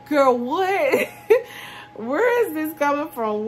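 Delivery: real laughter through the words; laughing disbelief. Real, not acted. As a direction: crack up at the nonsense. Laughing at the absurd claim.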